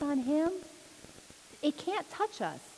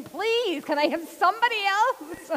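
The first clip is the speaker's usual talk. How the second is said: pleading angel; 8 semitones higher, 6 dB louder than usual. Pretend. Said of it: "pleading, saying".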